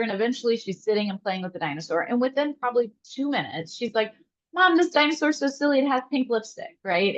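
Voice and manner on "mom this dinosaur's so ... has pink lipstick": lightly voiced happy little girl, pitched up; hint of a delighted child showing mom something; higher voice, quoting a giggly two-year-old without laughing; imitating amused toddler. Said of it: "she's laughing and she's not afraid of it".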